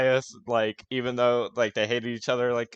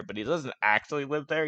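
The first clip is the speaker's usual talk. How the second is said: normal talking voice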